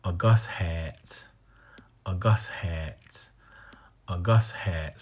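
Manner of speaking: A Gus hat slowly, stress on Gus; slow corrected demo. Shown as a demonstration. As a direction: model A Gus hat carefully. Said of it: "I need to remind him".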